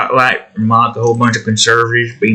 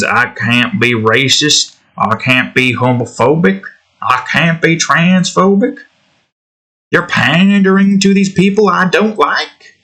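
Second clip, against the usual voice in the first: higher-pitched, climbing mock indignation; mock outrage; 7 semitones higher than usual. Streamer parodying people who complain about woke, mock outrage. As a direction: build from a plain list to high-pitched affronted complaint.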